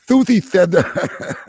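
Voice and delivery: Thuthi with a lisped th for s, laughing; lisping. Retold or imitated. Host giggles imitating the lisping voice, Thuthi lisped. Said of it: "What was she saying".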